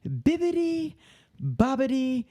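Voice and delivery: high, slow theme-park performer voice; tour host character voice. Retold or imitated. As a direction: lead the crowd, bright and high. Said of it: "I do the tours".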